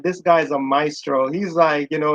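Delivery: normal talking voice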